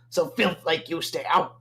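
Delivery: sneering goblin reply, looking down on him; disgusted goblin. Acted. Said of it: "replies in a very disgusted tone".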